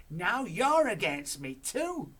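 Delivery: high, very loud, slow shout; Grandma shouts at her daughter. Acted. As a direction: feel betrayed. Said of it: "shouted Grandma".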